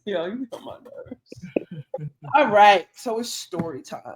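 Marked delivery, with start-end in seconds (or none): 0.0-2.1 slow, breathy whispered confession; whispered nerves. Real, not acted. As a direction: let the nerves slip out in a whisper. Nervous aside before story.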